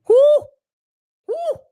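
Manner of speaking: a squealed high whoo, rising; high-pitched startled whoo. Real, not acted. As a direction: yelp a shocked whoo up high. Reacting to Mary's bad side.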